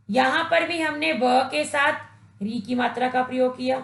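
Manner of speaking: ordinary talk, nothing marked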